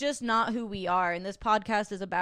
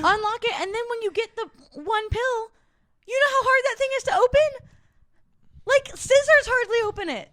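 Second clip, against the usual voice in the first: high, loud, exasperated; exasperated rant; 13 semitones higher, 9 dB louder than usual. Real, not acted. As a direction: rant about it, worked up. Ranting about hard packaging.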